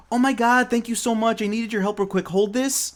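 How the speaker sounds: high-pitched, gushing fake thanks; mock gratitude. Cowdy sweet-talking a guard into holding something, falsely grateful. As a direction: play the helpless woman asking a favor.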